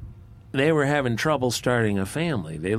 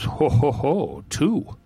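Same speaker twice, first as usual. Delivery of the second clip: drawn-out chortle, pitched up at the end; gloating snake; 4 semitones higher, slower than usual. Acted. Rattlesnake discovering two eggs in the nest, gleeful gloating. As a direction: savor the find with a slow "ho, ho, ho".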